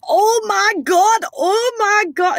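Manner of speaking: high, slow, drawn-out Scottish panic; Scottish accent. Retold or imitated. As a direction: stretch out a Scottish "oh my God". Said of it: "I don't know why she's Scottish all of a sudden".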